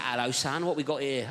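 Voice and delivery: low, casual builder voice; deep-pitched London geezer; South East London geezer. Retold or imitated. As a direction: greet them like a London builder. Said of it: "proper South East London geezer".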